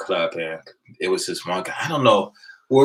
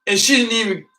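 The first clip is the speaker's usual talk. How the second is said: whiny complaint; 12 semitones higher, 4 dB louder than usual. Retold or imitated. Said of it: "He's like".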